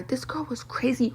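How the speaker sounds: real whisper, leaning in to gossip; whispered aside. Real, not acted. Telling the viewer about the girl in a whisper. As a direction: drop to a secretive whisper.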